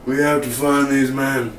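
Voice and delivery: slow, drawn-out survivor-villain voice; slow mock tough-guy order; imitating Wade's talk. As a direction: put on Wade's slow, deliberate delivery. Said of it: "he talks a little bit like this".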